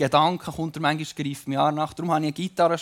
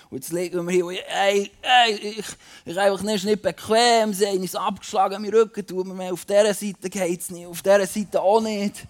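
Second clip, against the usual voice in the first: acting out Paul; 4 semitones higher than usual. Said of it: "ich tue ein bisschen vorspielen".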